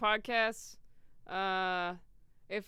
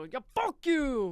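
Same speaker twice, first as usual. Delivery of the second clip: mild mock outburst, drawn out; half-scream; 5 semitones higher, 4 dB louder, slower than usual. Shown as a demonstration. Venting alone at home, half-scream. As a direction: let out a slow, half-hearted yell. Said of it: "Just scream in the mirror".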